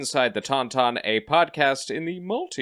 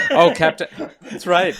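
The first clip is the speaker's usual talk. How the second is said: louder, higher laughing exclamation; 10 dB louder than usual. Real, not acted. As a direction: burst out laughing on the words. Laughs at Ahsoka joke.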